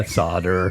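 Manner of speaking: "solder" said "SAH-der" with no L; American pronunciation. Shown as a demonstration. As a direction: drop the L in "solder", American style. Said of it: "I sound way American right now".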